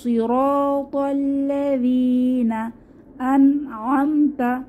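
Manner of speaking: slow chanted verse; tajweed reading; Quran recitation. Shown as a demonstration. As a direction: recite slowly, stretch যী. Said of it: "এক আলিফ টেনে পড়তে হবে".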